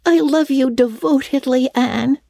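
put-on high, earnest little-girl voice; staunch devotion. A narrator's reading. Diana tells Anne she loves her, staunch. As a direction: declare it high, with girlish loyalty. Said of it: "said Diana staunchly".

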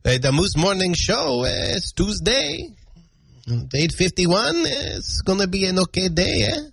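mock French-Canadian accent with tagged-on "eh"; hammed-up French-Canadian radio host. Shown as a demonstration. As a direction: demonstrate a broad Québécois English accent. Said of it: "If I speak like I'm from Canada".

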